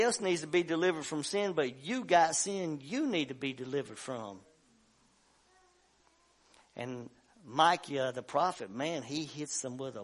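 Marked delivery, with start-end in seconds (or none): none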